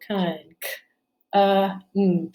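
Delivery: "Con" said whole, then split into "k, uh, mm"; teacher isolating each sound in "Con". Shown as a demonstration. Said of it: "how many sounds in the first syllable".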